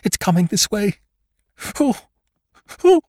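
panicked, rising to a high shriek; terrified cry. Acted. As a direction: cry out in sheer terror. Swede sees the shape approach.

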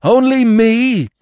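very high, loud, drawn-out cry; frantic dog at full pitch; frenzied cry. A narrator's reading. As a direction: cry out in indignant disbelief. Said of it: "he cried".

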